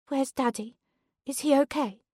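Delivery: put-on a little girl asking after her dad; small child asking. A narrator's reading. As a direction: daughter asking her mother about daddy. Small daughter asking after father.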